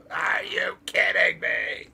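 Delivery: lightly voiced commentator, slow; Don West impression. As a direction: do the announcer in disbelief. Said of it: "at the pinnacle of his low register".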